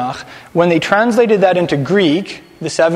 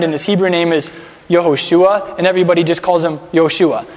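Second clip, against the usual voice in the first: ordinary delivery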